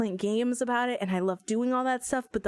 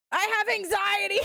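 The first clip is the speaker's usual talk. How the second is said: blurted shout; 10 semitones higher than usual. Retold or imitated. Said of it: "straight up kinda shouted that I had anxiety".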